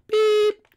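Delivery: very high, thin imitated beep; mock voicemail beep. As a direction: squeak it out like a machine tone. Imitates the answering machine beep.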